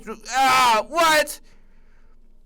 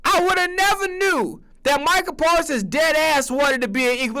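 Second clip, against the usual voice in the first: ordinary delivery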